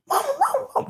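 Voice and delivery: dad mimics the kids' shrill wail; mocking high mama cry. Dad in the van mocking the kids crying for mama, very high. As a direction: squeak it out high, mocking the children. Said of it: "He said".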